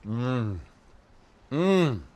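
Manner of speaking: normal talking voice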